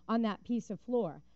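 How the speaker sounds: ordinary delivery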